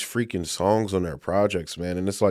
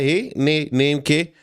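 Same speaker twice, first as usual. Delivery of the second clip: light fake Jamaican accent, a bit higher; 5 semitones higher, slower than usual. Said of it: "fake Jamaican accent".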